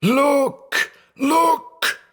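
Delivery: very high, strangled screams; choked cries. A narrator's reading. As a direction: cry out while being choked. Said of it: "stifling cries of".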